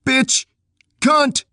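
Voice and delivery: high, loud insult yelled from a distance; yelled back. Acted. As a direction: hurl the insults back over your shoulder. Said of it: "He stopped there and yelled back".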